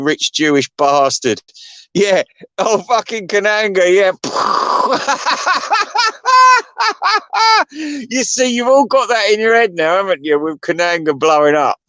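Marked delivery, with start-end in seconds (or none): 4.2-7.7 high laughter. Real, not acted. Big laugh at Kananga joke.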